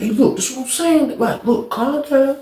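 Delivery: soft, quiet, calming talk; super calm. Retold or imitated. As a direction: settle him down gently. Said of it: "Old dude was super calm".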